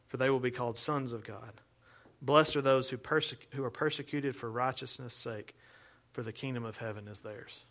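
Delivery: solemn Bible reading to the congregation; scripture reading. Shown as a demonstration. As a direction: read each blessing deliberately, letting the ends fall away. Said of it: "Okay, listen".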